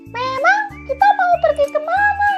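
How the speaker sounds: very high, squeaky chicken voice; chicken character. Acted. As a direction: ask in a loud, high-pitched chicken squeal. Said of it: "tanya ayam".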